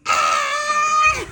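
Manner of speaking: high loud shriek of fright; a sudden retold scream, very high and loud; startled scream. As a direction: shriek as if the eyes are on you. Recoiling from the mounted heads.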